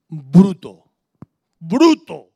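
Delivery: low, loud mock self-rebuke; loud self-scolding. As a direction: bark the word out loud and low. Scolding himself as stubborn.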